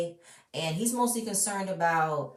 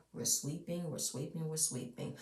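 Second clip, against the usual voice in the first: softly teasing; 5 semitones lower, 13 dB quieter than usual. Pretend. Mocking family sweeping issues.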